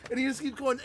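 normal talking voice

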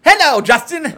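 high, loud hammed-up Japanese accent; a cartoonish Japanese greeting; mock Japanese accent. Greeting Justin in a put-on Japanese accent.

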